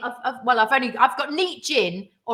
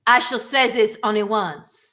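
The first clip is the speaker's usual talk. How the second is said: mock French Resistance voice; 4 semitones lower, 3 dB louder, slower than usual. Quiz about 'Allo 'Allo catchphrase.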